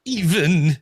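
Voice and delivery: hammy cartoon afterthought; Snagglepuss. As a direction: tack it on like a cartoon catchphrase. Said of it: "That was Snagglepuss".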